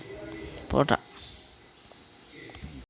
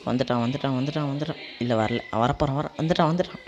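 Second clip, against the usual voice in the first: 1 tense hushed; 8 dB quieter than usual. Real, not acted. Boss arriving, tense countdown.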